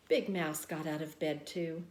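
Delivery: ordinary delivery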